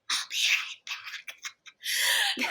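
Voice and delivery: very high, very slow breathy squeak; tiny high voice while fleeing the crowd; squeaky excuse. Retold or imitated. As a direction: squeak it out as you slip away. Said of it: "And I'm like".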